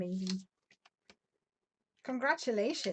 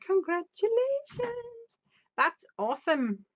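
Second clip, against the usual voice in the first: excited; 10 semitones higher, 4 dB louder, faster than usual. Real, not acted. Congratulating viewer's anniversary.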